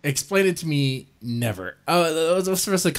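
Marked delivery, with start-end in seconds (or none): none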